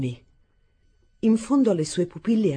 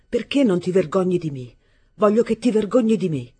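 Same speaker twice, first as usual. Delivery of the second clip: rising furious yell, louder and higher; angry shout; 4 semitones higher, 6 dB louder than usual. Acted. Arturo yelling at his stepmother to be ashamed, furious shout. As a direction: shout down at her with contempt. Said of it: "le gridai".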